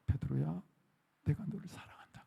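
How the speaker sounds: soft, breathy whispered love; tender whisper. Retold or imitated. Jesus whispering love to Peter, soft tender whisper. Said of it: "그러는 거예요".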